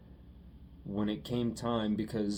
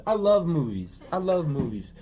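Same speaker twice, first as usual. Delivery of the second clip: loud declaration; 6 semitones higher, 6 dB louder than usual. Real, not acted.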